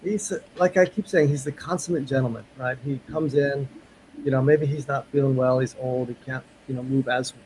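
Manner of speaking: ordinary talk, nothing marked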